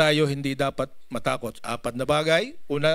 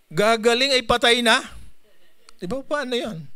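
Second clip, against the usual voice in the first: incredulous question; 7 semitones higher than usual. Real, not acted. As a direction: ask it as if it can't be true. (hindi makapaniwalang tanong)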